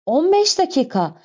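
high-pitched cry of disbelief; shocked exclamation. A narrator's reading. Madeleine hears how long the jack will take, shocked exclamation. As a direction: exclaim it, pitch leaping up then falling. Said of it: "diye haykırdı Madeline".

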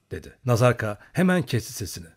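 ordinary delivery

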